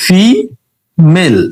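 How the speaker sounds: Female split slowly into syllables, fi and mel. Shown as a demonstration. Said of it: "যদি শব্দকে ভাঙি".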